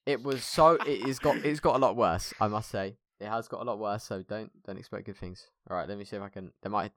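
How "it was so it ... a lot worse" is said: ordinary talk, nothing marked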